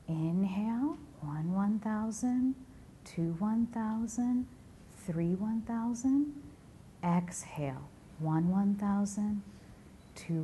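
slow, spaced-out meditation voice; guided meditation. Acted. Guiding the audience to breathe on a sunrise beach, guided meditation. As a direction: ease them gently into the imagery, with long pauses.

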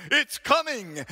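loud, high shout. Retold or imitated. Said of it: "he shouted a second time".

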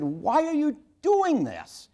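high, slow, drawn-out demanding why; father pressing his son to explain, held back from yelling; earnest anger. Retold or imitated. Father to six-year-old son peeing in wastebasket, stern earnest anger. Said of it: "the anger in my voice".

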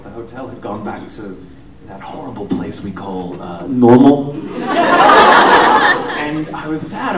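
usual speaking voice

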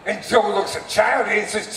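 ordinary delivery

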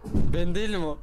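loudly insisting it wasn't me; loud denial. Real, not acted. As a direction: protest it loudly. (yüksek sesle inkâr)

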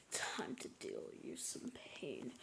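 soft, low, unvoiced villain threat; menacing whisper. Villain threat after returning.

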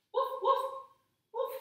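put-on teacher barking for the kids in dog pose; playful dog bark. Pretending to be a dog.

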